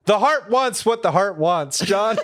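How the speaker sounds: a dramatic mock confession through laughter; mock sentimental. Cohost teases host about the Ohio trip, laughing mock-sentimentally.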